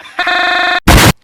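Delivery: loud burst of laughter. Real, not acted. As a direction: laugh out big.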